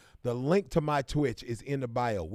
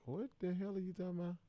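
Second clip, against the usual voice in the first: mock baffled falsetto; 7 semitones higher, 9 dB quieter, faster than usual. Baffled by a viewer's comment.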